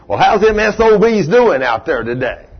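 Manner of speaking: a hint of a gruff old man cussing; mildly hammed-up cranky old mechanic's customer; rough old cusser. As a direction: lightly play the foul-mouthed customer. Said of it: "that's the way he talked".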